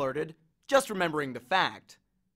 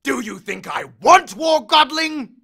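huge roar, much louder and higher, slowed; bellowing; 9 semitones higher, 11 dB louder, slower than usual. Acted. Hades bellows at Percy, furious roar. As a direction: roar it at the boy. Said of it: "Hades bellowed".

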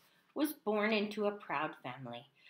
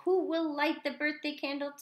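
higher-pitched mother voice; mother's question; 7 semitones higher, 3 dB louder than usual. A narrator's reading. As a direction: ask it as the dragon's mother, pitched up. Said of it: "asked his mother".

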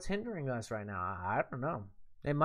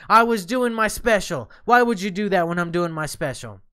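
indignant yelling; 8 dB louder than usual. Real, not acted. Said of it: "it's impolite".

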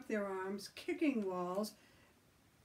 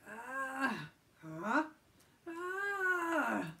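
groans climbing higher; rising groans; 6 semitones higher, much slower than usual. Acted. Tom groaning.

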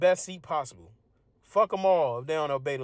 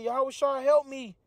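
mock plea; 9 semitones higher than usual. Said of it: "cats that are gonna say".

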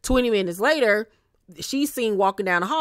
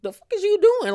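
incredulous; 12 semitones higher than usual. Retold or imitated. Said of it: "Chanel looking like".

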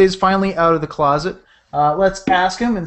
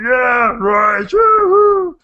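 zombie cheer; 5 semitones higher, 5 dB louder, much slower than usual. Retold or imitated.

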